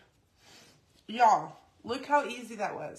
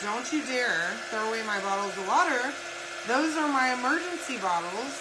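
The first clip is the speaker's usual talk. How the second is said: louder, half-mock rant at the husband; retold freak-out; 2 semitones higher, 5 dB louder than usual. Wife scolding Chris for tossing her water bottles, loud mock panic. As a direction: replay the panic over your water bottles. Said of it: "I'm freaking out on him".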